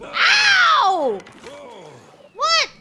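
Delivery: sudden high loud yelp; startled cry of pain. Real, not acted. Fish bites player.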